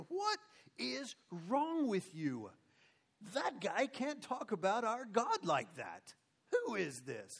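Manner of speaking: high, slow, drawn-out disbelief; acted indignant kid, stretching each word; incredulous outrage. Young David scolding all these grown men, incredulous.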